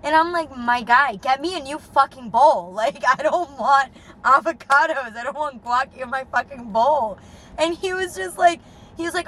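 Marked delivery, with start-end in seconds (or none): 0.6-7.2 louder, exasperated retold rant; mock furious. Demanding a new bowl from the date, angry. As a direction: vent at him, fed up and loud. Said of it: "I get so mad".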